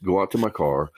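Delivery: ordinary delivery, nothing marked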